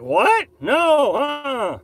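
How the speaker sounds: incredulous border guard. Retold or imitated. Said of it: "the Canadian border's like".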